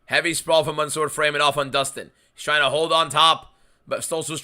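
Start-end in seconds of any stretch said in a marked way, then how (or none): none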